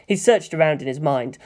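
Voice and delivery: ordinary delivery, nothing marked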